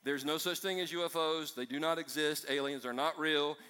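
ordinary delivery

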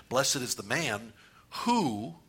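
"who" held long at the end; stress on WHO. Shown as a demonstration. Preacher demonstrates meditating word by word, stress on "who". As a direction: slowly build up to a drawn-out "who". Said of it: "just emphasize the word as you go".

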